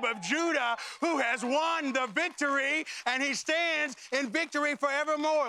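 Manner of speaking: triumphant sermon call; preaching. Acted. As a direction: proclaim the Lion of Judah's win. Preaching the victorious lion.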